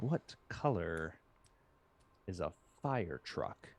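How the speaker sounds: drawn-out, quiet mock pondering; slow, puzzled repeat. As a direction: ponder it slowly and softly, as if stumped. Quoting husband puzzling over easy question.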